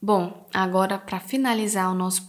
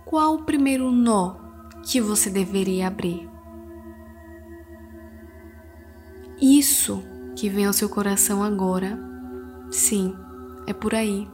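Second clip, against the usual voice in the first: soothing spa whisper; 3 dB quieter than usual. Acted. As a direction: guide them gently into calm. Leads a guided meditation. (voz suave de meditação guiada)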